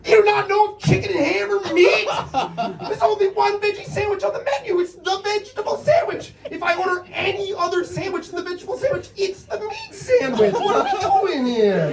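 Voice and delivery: incredulous rant. Retold or imitated. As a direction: build the outrage as the logic falls apart. Ranting about meat sandwich logic.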